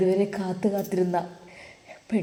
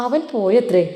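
emphatic repeat; 4 semitones higher, 9 dB louder than usual. Acted. Talal repeats news emphatically.

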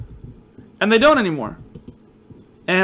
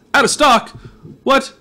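sudden high, louder cry of surprise; surprised outburst; 7 semitones higher, 4 dB louder than usual. Real, not acted. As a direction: yelp it, caught off guard. Shop empty while buying.

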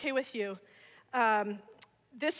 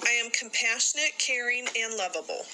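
creed recitation; 2 semitones lower than usual. Acted. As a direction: lead the room line by line. Leads daily creed, class repeats.

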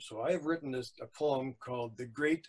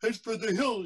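high, loud rallying call; acted urgent command; shouted order; 8 semitones higher, 7 dB louder than usual. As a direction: shout it as the town mayor. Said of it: "shouted the mail-, mayor".

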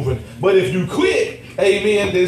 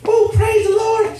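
high, slow churchy praise; a church lady greeting him in Walmart, high and slow; 12 semitones higher, slower than usual. Retold or imitated. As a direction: sing out like an excited church lady. Voicing a lady.

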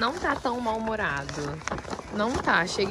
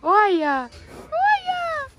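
pet talk; 10 semitones higher, slower than usual. Real, not acted. Owner cooing to her horse over a biscuit, high baby talk.

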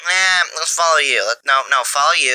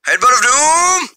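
hammed-up heroic battle cry, higher and louder; epic move call; 8 semitones higher, 4 dB louder than usual. Gamer calling out his melee attack in a hammed-up battle cry. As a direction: shout it like an anime attack name.

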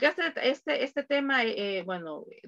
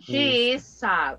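slow model answer; 2 semitones higher, 4 dB louder, slower than usual. Shown as a demonstration. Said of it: "O puedo decir, she's sad".

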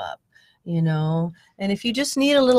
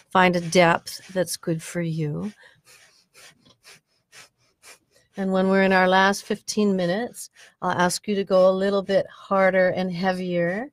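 guided meditation. Acted. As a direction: guide the breathing gently, with long pauses. Leads the breathing meditation.

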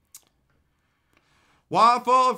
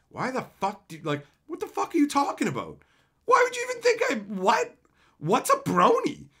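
confused outrage; 6 semitones higher than usual. Retold or imitated. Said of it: "and I'm like".

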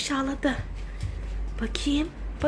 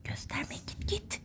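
hushed whisper, unvoiced; whispering while hiding; 22 dB quieter, slower than usual. Real, not acted. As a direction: whisper urgently so nobody hears. Hushing child while hiding.

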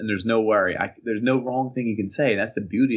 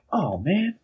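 higher-pitched reluctant groan of words; mock dismay; 8 semitones higher than usual. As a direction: imitate a kid caught unprepared. Acts kid told to memorize.

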